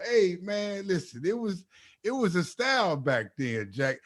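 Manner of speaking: lightly hammed seventies slang guy; imitating dad. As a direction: play the seventies cool cat. Dad's dated slang quoted.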